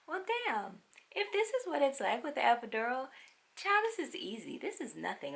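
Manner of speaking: high, pleased, almost giddy; cocky inner voice. Retold or imitated. Mother in labour to herself, giddy and high. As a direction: brag to yourself mid-labour. Retelling her own cocky thoughts.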